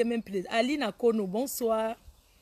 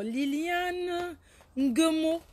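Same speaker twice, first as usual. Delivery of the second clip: slow name reading; 6 semitones higher, much slower than usual. Shown as a demonstration. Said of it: "prononce mon nom alors".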